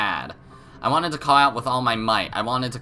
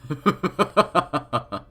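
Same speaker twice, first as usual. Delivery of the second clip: creepy chuckle; 5 semitones higher than usual. Acted. Villain laughs at her question.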